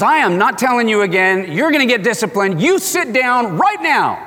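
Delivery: a dad losing patience with his daughter; hammed-up angry father; stern dad, rising. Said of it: "He goes".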